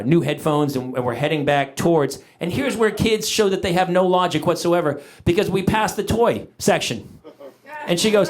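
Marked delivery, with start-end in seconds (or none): none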